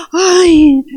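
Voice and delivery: high, loud gasp and frightened cry; startled gasp. Acted. Preacher acting out the disciples' shock at seeing Jesus, startled. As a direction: gasp in shock, then a drawn-out mock cry. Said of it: "se turbaron".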